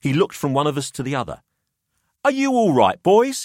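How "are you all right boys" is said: put-on higher, smug older host; a wry businessman weighing up the boys; Pelham's voice.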